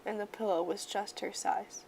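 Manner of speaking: plain reading voice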